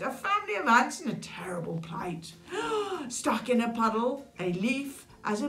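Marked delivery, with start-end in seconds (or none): 2.4-3.0 high, dramatic gasp of alarm; storybook intake of breath. A narrator's reading. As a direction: gasp as if witnessing a mishap. Narrator gasps at ants' plight.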